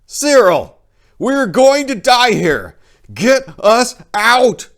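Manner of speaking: high, loud, slow urgent plea; desperate plea. A narrator's reading. Narrator begging Cyril to rescue them, desperate. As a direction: beg him to get them out. Pleading in burning house.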